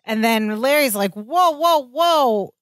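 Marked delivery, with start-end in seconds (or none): 1.1-2.5 a shocked friend pulling up short; stunned, stopping him cold; shocked whoa. Retold or imitated. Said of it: "he's totally shocked".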